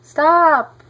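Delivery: high, loud desperate shout; boy shouting stop at the swim team; urgent cry. A narrator's reading. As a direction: yell to halt the swimmers. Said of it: "cried Louis".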